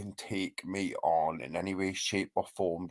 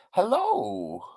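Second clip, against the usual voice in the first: sing-song hello; 10 semitones higher than usual. Real, not acted.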